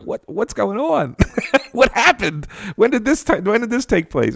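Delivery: high, laughing, incredulous questions; mock disbelief. Asking the reconciled reporters what happened, laughing disbelief. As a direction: play up the bafflement with a laugh. Recalling his shock at reconciliation.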